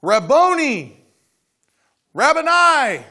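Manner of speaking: loud, high cry of recognition; overwhelmed joyful cry; cried out. Acted. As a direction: cry out to the teacher. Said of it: "cried out".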